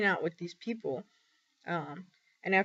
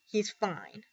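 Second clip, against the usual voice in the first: mild snarly comment; 2 semitones higher than usual. Retold or imitated. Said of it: "gave me a snarly comment".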